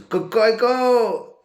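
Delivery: imitation de coq, haute et forte; crowing a long, high, loud cock-a-doodle-doo; cocorico. Crowing to cheer on Jérôme. (imitation sonore du chant du coq)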